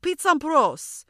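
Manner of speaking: put-on high, loud, drawn-out French accent; Julien. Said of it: "I didn't understand his accent".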